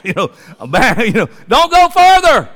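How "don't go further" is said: high, loud warning call; donkey's loud warning. Acted. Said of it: "It's like".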